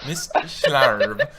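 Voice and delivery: laughing Scottish Schlarb; Scottish lilt, laughing through it; Scottish accent. Pretend. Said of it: "he had to go full, like, Scottish".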